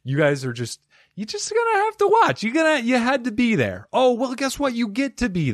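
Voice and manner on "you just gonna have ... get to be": much higher, teasing squeak; cartoonish squeal with a sing-song lilt; squeaky voice. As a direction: tease them in a silly high voice.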